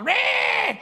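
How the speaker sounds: a squeaky high mocking "Meh!"; mock scoff. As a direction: jeer it like a critic.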